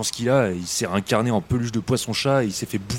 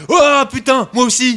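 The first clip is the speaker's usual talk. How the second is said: high, loud stunned outburst; shocked cry; 11 semitones higher, 6 dB louder, slower than usual. Acted. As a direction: yell in sudden horror. Discovers own transformation.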